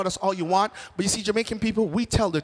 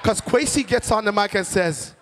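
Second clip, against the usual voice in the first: normal talking voice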